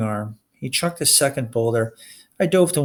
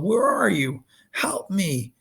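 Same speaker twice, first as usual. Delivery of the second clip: pleading call; 7 semitones higher, slower than usual. Acted. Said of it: "the older Cyclops called".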